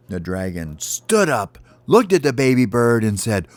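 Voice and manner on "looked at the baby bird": usual speaking voice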